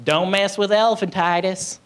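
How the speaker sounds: a hint of a mock tough slogan; mock bragging sufferer. Someone bragging about elephantitis, mock warning. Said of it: "I don't know anybody who's like".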